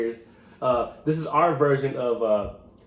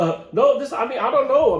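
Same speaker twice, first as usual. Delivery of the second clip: flustered; 11 semitones higher, 8 dB louder than usual. Real, not acted. Flustered reply.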